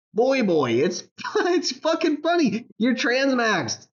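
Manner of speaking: high, slow laughing mockery; laughing taunt. Real, not acted. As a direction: draw it out high while laughing. Laughing mock.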